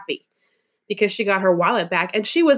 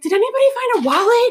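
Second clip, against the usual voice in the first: much higher, louder, anxious stranger's voice; frazzled woman; 13 semitones higher, 4 dB louder, faster than usual. Retold or imitated. Woman who lost her wallet asks the salon, high frantic. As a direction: play the panicked owner, pitched way up. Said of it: "a woman comes in and she's like".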